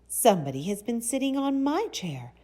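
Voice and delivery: high, sweet mother bear voice, projected; high-pitched, loud, sweet storybook bear; sweet Mama Bear. A narrator's reading. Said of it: "in her sweet, soft voice".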